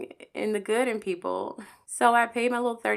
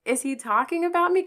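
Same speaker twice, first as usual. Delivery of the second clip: stunned question; 9 semitones higher than usual. Retold or imitated. Hearing the radio show.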